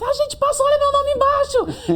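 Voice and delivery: very high, loud, laughing with delight; friend's joyful cry. Retold or imitated. Friend on video call announcing they both passed, laughing shout. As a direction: shriek it out between laughs. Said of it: "Aí ela foi e disse".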